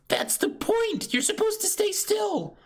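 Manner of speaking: squeaky high doctor scolding a patient; little doctor scolding. Acted. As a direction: scold the patient like a tiny nurse. S0 voicing the doctor scolding Zoro.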